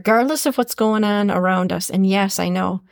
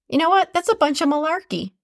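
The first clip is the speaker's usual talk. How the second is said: dismissive; 7 semitones higher, faster than usual. Real, not acted. Dismissing the naysayers.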